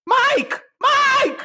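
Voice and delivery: high shouted name calls; yelling for a kid. Pretend. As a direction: call the runaway kid at the top of your voice. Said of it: "get really good at yelling".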